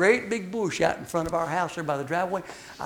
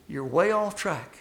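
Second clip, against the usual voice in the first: ordinary talk, nothing marked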